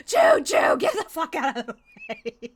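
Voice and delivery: high, loud choo-choo yell easing off; mock yell. Game master recapping Guy's train warning, mock yell. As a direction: yell it like a train whistle, then let it drop. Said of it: "guy just yells".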